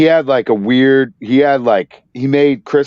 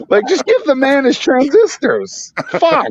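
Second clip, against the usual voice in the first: high-pitched comic exasperation; exasperated rant; 10 semitones higher than usual. Acted. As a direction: rant in mock outrage, voice shooting up. Mock exasperated rant at store policy.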